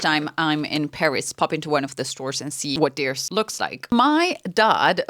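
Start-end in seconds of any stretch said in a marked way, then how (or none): none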